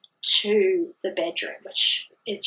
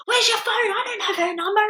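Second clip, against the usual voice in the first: high-pitched panicked demand; mock frantic cry; 14 semitones higher, faster than usual. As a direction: imitate a flustered partner at his wits' end. Acting panicked partner hunting phone.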